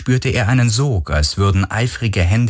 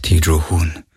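usual speaking voice

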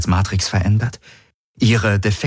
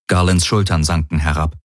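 plain reading voice

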